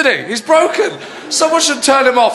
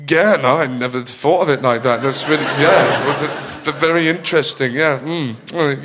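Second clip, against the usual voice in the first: low, softer, thoughtful murmuring; pondering listener; 8 semitones lower, 3 dB quieter than usual. Acted. Listener pretending a stupid remark is profound, pondering. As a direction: mull it over as if it were deep. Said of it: "you might have to stand there and go".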